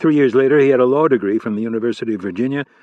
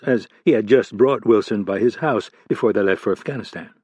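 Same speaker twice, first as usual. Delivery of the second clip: ordinary delivery, nothing marked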